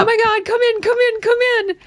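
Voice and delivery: high-pitched panicky mock plea; freaked out. She begs blistering guy inside, acted freak-out. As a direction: imitate a frantic, high-voiced invitation. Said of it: "then she's all freaked out".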